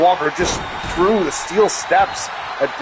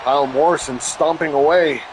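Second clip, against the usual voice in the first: ordinary talk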